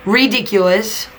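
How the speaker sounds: ordinary delivery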